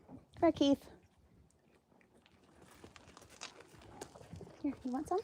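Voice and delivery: coaxing pet voice. Real, not acted. Offering food to animal.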